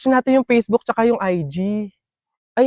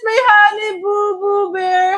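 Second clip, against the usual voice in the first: gushing sweet-talk; 6 semitones higher, slower than usual. Acted. Sweet greeting again.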